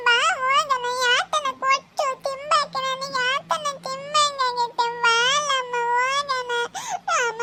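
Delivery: put-on high, slow tearful child's whine; crying whine. A crying child begs her mother, whining. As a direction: sob it out like a child begging.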